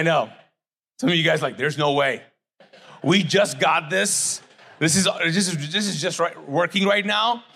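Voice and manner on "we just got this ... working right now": normal talking voice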